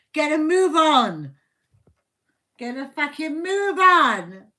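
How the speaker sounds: loud, exasperated prodding; impatient urging. Acted. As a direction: hurry a slowpoke along, forcefully. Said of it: "poke at your ass and say".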